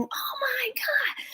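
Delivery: high, slow, hushed mock scream; imitated scream. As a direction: cry out in alarm without volume. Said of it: "screaming and yelling".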